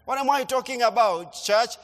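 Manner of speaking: ordinary talk, nothing marked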